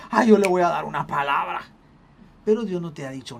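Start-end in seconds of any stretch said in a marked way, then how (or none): 0.1-1.6 mock emotional outburst. Mocks an over-emotional believer's reaction.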